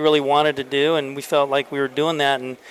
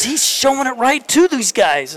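higher, slow mock-exasperated coach; imitating frustrated Tony; 9 semitones higher than usual. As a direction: replay the coach's disbelief, stretched out. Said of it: "And he goes".

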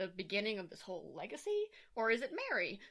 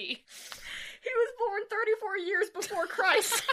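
slow, laughing through the words; laughing correction; 11 semitones higher, 6 dB louder, much slower than usual. Real, not acted. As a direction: correct her while cracking up.

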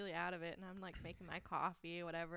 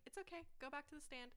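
gentle; 8 semitones higher, 4 dB quieter than usual. Retold or imitated. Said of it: "she's just like".

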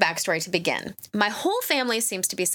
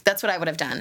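ordinary talk, nothing marked

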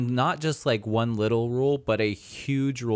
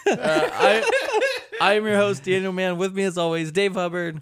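upbeat radio host voice; show host intro; 8 semitones higher than usual. Acted.

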